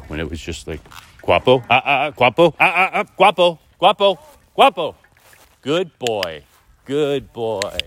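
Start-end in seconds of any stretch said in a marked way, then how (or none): 1.2-4.9 loud, high call across the street; real loud high-pitched dog call. Real, not acted. Calling the dog on a walk. 5.6-7.8 higher, bright pet praise; praising the dog. Real, not acted. Praising the dog after calling.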